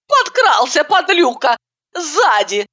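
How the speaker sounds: bashful scolding. A narrator's reading. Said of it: "стыдливо зарделась Катерина".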